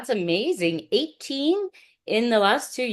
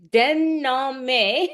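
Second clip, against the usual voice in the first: Denhame drawn out in a higher French accent, rising; 4 semitones higher than usual. Shown as a demonstration. Host having a second go at the guest's surname, careful French accent. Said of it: "get my French accent going".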